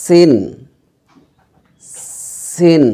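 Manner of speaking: সিন with a sharp sibilant s; whistled s starting সিন; hissing S. Shown as a demonstration. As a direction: let the s of সিন sound out. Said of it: "একটু আওয়াজ বের হবে".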